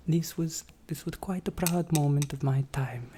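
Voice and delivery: ordinary talk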